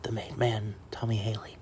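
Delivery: hushed, low golf-broadcast introduction; serious announcer voice. Pretend. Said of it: "serious voice here going".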